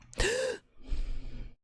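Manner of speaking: quick startled gasp, high and breathy; sharp gasp. Real, not acted. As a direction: suck in air in alarm. Sudden danger in fight.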